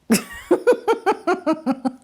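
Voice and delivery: high-pitched amused laugh. Real, not acted. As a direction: laugh at your own joke. Laugh at own joke.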